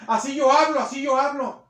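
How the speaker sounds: loud, high snapped retort; rude defensive shout. Retold or imitated. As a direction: throw it back defiantly. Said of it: "y le grita a la gente". (réplica grosera y gritada)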